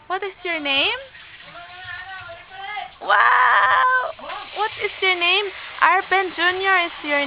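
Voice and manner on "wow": bright excited exclamation, higher and louder; thrilled little shout of joy; delighted wow. Real, not acted. Delighted mother cooing at baby.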